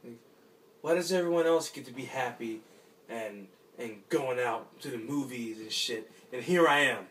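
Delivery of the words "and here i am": frustrated outburst. Acted. Lonely man breaks out in frustration.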